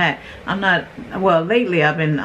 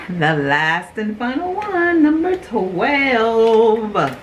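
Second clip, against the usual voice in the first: slow announcement, last word hugely stretched; grand announcement; 3 semitones higher, much slower than usual. Real, not acted. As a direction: draw out the final number like a showman. Announces the final box grandly.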